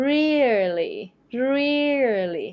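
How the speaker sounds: "really" with a heavy, drawn-out REE; first-syllable stress. Shown as a demonstration. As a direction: lean hard on the start of "really". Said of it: "ให้เราเน้นเสียงหนักที่พยางค์แรก".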